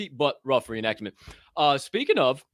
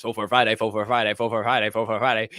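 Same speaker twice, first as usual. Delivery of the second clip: tongue twister run; 3 dB louder, faster than usual. Shown as a demonstration. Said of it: "Say that ten times fast".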